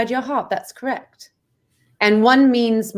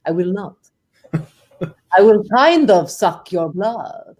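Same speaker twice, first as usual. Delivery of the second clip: put-on Russian-accented vampire; 4 semitones lower than usual. One tooth vampire refuses discrimination, mock-menacing Russian accent. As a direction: declare it as a hammy Russian vampire. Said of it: "Your Russian accent".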